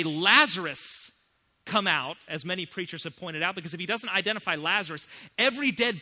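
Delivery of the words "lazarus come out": loud, slow, drawn-out summons; commanding call. Acted. Jesus summons Lazarus by name, slow loud call. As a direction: stretch the name, then order. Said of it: "And he has to say".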